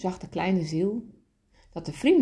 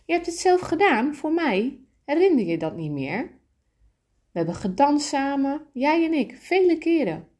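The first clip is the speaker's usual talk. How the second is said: higher, lilting friendly soul voice; 8 semitones higher, 4 dB louder than usual. Acted. Friendly soul reminding the little soul they danced together, higher and lilting.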